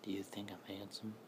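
hushed creepy voice of the Slit Mouth Man. Shown as a demonstration. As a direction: breathe the question like a creep. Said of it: "I mean, creepy voice".

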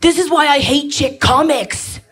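mock frustrated male heckler; mock annoyed dude. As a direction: grumble like a bored boyfriend. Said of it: "looking at me like".

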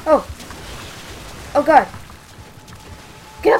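loud frightened cry; startled scream. Real, not acted. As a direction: yelp it in sudden fright. Monster suddenly appears.

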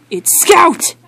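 high, loud outburst; indignant yell. Acted. Scout correcting the guard about his name, indignant yell.

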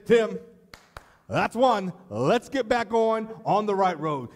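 loud, high stern warning from God; God's voice. Retold or imitated. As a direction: warn the pastor firmly. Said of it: "God needs to look down and say".

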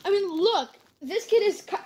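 usual speaking voice

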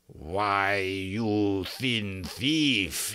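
very slow, put-on villain voice; menacing Rook. A narrator's reading. Rook catching the magician at the cage, slow menace. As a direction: stretch each word out in slow menace.

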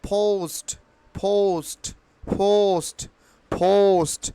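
"পোস্ট" as phoust, long gliding o; corrected pronunciation. Shown as a demonstration. Teacher drilling learners, "পোস্ট" with ou glide. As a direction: puff the p and stretch the o in "পোস্ট". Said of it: "ট এর মতোই উচ্চারণ করতে হবে".

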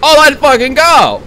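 a loud, high, jubilant cheer; excited celebration. Real, not acted. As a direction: cheer it out, thrilled it worked. Celebrating success.

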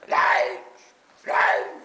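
short loud shouts; shouting. Retold or imitated. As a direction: shout it out like a rival in a crowd. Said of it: "this Transvaal boy was shouting".

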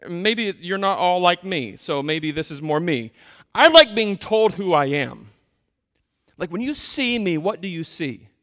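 ordinary talk

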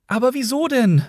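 high-pitched bewildered question; protesting question. A narrator's reading. Trillian protests to the policemen.

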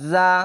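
জা with a strongly buzzed z; buzzing sound. Shown as a demonstration. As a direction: hold a buzz into জা, "zzaa". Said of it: "ঝিনঝিন আওয়াজ হবে". (জা, ঝিনঝিন আওয়াজে)

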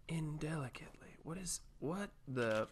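hushed, voiceless whisper. Real, not acted. Player puzzling over what was found, whispering.

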